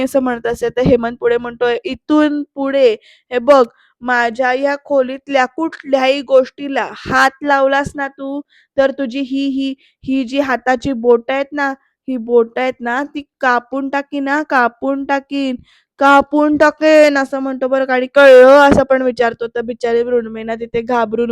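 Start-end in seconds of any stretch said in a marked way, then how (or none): 1.9-17.2 slow warning building to a loud finish; menacing threat. Retold or imitated. Acted father threatens, building to shout. 18.2-18.6 very loud one-word check; sudden loud burst; barked demand. Retold or imitated. Acted father demands whether understood.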